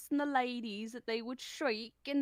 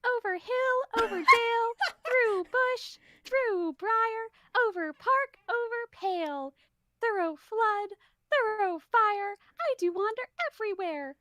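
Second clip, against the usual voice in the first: squeaky, much higher cartoon mouse voice, bouncing along; Mickey Mouse voice; 7 semitones higher, 5 dB louder than usual. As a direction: play the fairy as a full-on Mickey Mouse. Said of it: "You went full Mickey Mouse for this one".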